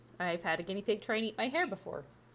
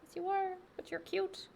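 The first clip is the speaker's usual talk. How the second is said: pet voice; 10 semitones higher, 3 dB quieter than usual. Real, not acted. Baby talk to guinea pig.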